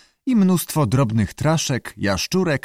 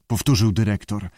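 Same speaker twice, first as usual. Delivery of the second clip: ordinary delivery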